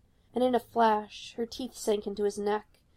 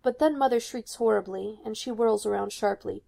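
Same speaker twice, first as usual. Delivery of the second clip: ordinary delivery, nothing marked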